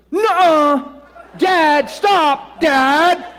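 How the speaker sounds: put-on loud, high pleading kid's voice; a son begging his father to stop praying, high and loud; panicked kid. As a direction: yell at your dad to stop, high and scared. The boy pleads with his dad.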